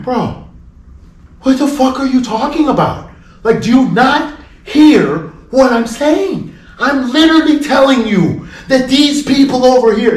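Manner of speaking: loud, high-pitched protest; forceful, emphatic acted-out appeal; exasperated pleading. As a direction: plead as if he just will not listen. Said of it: "I'm pleading with him now".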